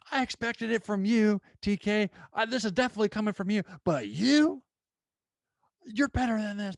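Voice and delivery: a half-hammed yell of a furious dean; mock angry dean. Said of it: "She starts yelling at us".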